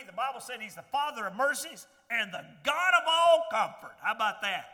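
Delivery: loud, building preacher's cadence; ringing pulpit delivery; full-force preaching. Acted. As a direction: lift the congregation with rising fervour. Preaching rises again.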